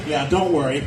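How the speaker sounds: normal talking voice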